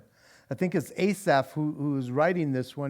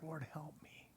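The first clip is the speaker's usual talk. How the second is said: breathy whispered prayer, barely voiced; hushed whisper to God; whispered plea; 2 semitones lower, 26 dB quieter than usual. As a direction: whisper it like a quiet desperate cry. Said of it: "we sometimes cry like".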